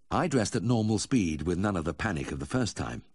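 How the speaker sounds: usual speaking voice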